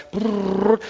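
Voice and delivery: high playful "prrr" sound effect; a quick, playful mouth noise; imitated whirring noise. Preacher telling of the raid with a high playful "prrr".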